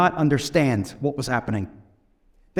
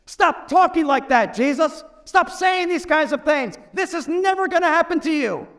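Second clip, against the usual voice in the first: higher-pitched pleading rebuke; rebuking; 10 semitones higher than usual. Retold or imitated. Peter rebuking Jesus aside, high insistent rebuke. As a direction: push back hard, pitch up, insistent. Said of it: "he rebukes Jesus".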